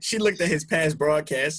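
ordinary talk, nothing marked

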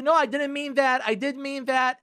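high, loud mock backpedalling; flustered wife. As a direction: play the wife tripping over her words. Wife flip-flops in acted quarrel.

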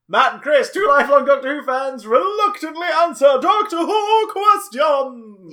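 loud, slow show announcer; big announcer intro. Acted.

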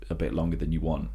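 whispered aside. Real, not acted. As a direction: breathe it as a whisper. Whispered line.

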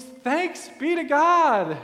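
loud, high, slow cry of joy; joyful exclamation. Acted. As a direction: shout your thanks out. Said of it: "with the cackle ... she exclaims".

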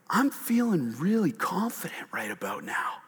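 secretive whispered confidence. Pretend. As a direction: lean in and whisper it smugly. Disciple feeling confident, hushed aside.

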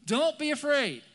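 high, loud "don't be afraid"; loud command. Acted. Jesus calls to frightened disciples on the water, loud command. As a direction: call it out forcefully over the storm.

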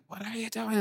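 high-pitched retold scream, held back in volume; high, half-voiced mock yell. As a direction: imitate a screaming dad without full volume. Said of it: "he just started screaming at me".

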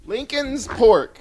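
"Lincoln's Pork" said slow and high, each word stretched; silly mispronunciation. Pretend. Mocking the band name.

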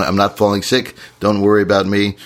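normal talking voice

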